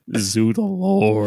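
slow mumbled imitation of Joaquin Phoenix's sad Napoleon; depressed Napoleon. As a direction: drag each word, worn out and mopey. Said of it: "He sounds so fucking depressed".

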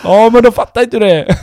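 father shutting down the excuse; dad answering son's excuse heatedly; dad retort. Retold or imitated. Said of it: "han skulle bli rasande".

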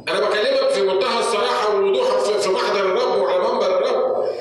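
high, impassioned preacher's cry; impassioned high preaching, crying out to the congregation; full-force preaching. Acted. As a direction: preach it out with urgent conviction.

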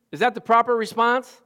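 usual speaking voice

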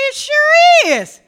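high, slow and drawn-out, full of joy; delighted reply. Retold or imitated. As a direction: sing it out in wonder at the rain. Pastor retells answering the Lord.

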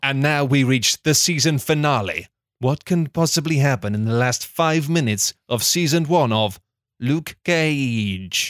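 measured, deadpan storyteller read; deliberate storybook delivery; story narration. A narrator's reading. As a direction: narrate a silly recap like a serious tale. Script narration.

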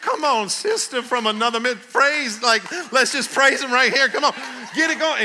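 high, loud, laughing call to praise; rally her to praise right there; excited praise call. Retold or imitated. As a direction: Come on sister, shouted high with laughter. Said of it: "I said".

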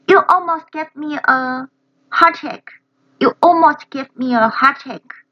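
imitating startled Lenny. Learner echoing Lenny scared by his brother, startled. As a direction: jump as if scared by your brother. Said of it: "Lenny被他的哥哥Frank吓到了".

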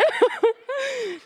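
high, breaking laugh; nervous laugh. Real, not acted. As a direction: laugh through emotion.

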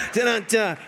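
dramatic sting. Acted. Punchline sting after joke.

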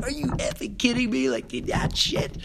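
high, strained mock rage; angry outburst. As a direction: spit it out like a furious student. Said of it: "He's like".

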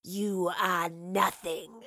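slow, snarling monster voice; wraith's snarl. A narrator's reading. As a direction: snarl it slowly as a menacing wraith. Wraith threatens the professor.

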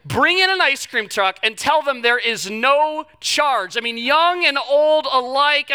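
slow, high, loud enthusing; excited. Real, not acted. Pastor raving to the church about the free ice cream truck, excited. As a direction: sell the joy big and drawn out.

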